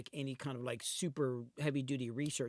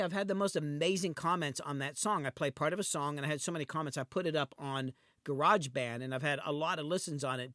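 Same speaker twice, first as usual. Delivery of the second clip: ordinary talk, nothing marked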